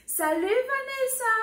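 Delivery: high, bright-pitched hello to a friend; cheerful greeting. Acted. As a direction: call out happily to your best friend. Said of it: "so when I see Vanessa, I'll say".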